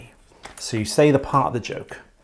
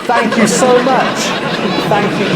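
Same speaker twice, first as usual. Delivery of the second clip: higher, louder hammed-up thanks; mock applause thanks; 8 semitones higher, 4 dB louder, slower than usual. Host pretending to thank a cheering crowd. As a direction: play the comic milking applause.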